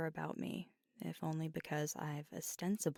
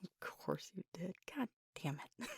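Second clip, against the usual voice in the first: amused exasperation; 2 semitones higher, much faster than usual. Acted. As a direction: laugh helplessly while grumbling. Laughs at a doctor joke.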